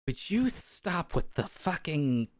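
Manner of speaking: slow exasperated scold. Acted. NPC scolds laughing companion.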